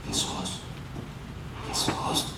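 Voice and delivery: whispered, barely voiced plea; weak whisper. Shown as a demonstration. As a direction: breathe the name out with no voice. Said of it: "cómo le habrían gritado". (susurro débil y sin fuerza)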